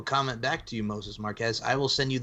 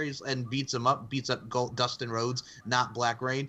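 usual speaking voice

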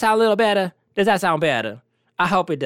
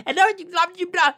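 high-pitched blah-blah imitation; mock chatterbox in a high pitch; mocking interrupting chatter; 10 semitones higher, faster than usual. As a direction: mimic someone butting in, high and babbling. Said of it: "you be like".

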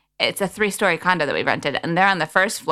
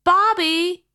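put-on higher, drawn-out Australian baby-talk Bobby; 11 semitones higher than usual. Pushed by her friend, higher Australian baby-talk attempt. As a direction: coo Bobby like an Australian toddler. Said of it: "that's quite a dialect there".

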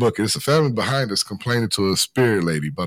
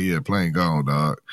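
flat bored agent; 9 semitones lower, 4 dB quieter, slower than usual. Retold or imitated. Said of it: "He like".

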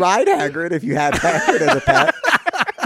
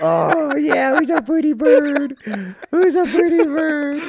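high, slow parrot-teaching coo; 11 semitones higher, 4 dB louder, slower than usual. Retold or imitated. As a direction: coo at the bird like a pet owner. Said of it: "the bird's like".

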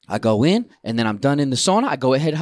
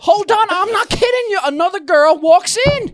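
loud, very high incredulous delivery; worked-up storytelling; 6 dB louder than usual. Real, not acted. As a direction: exclaim it at the peak of the tale. Storyteller at peak of tale.

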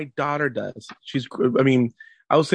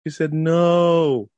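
put-on higher kid voice, very drawn-out; imitated earnest son; 6 semitones higher, much slower than usual. Son reporting sister's answer, slow imitated earnestness. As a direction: stretch the last word like a child.

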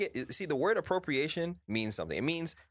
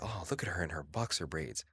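low, soft mocking quote; mock magazine voice; 10 semitones lower, 5 dB quieter than usual. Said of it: "then People magazine called it".

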